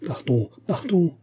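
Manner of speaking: high, drawn-out urgent cry; mild slow shout. A narrator's reading. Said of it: "cria le surintendant dès qu'il le vit".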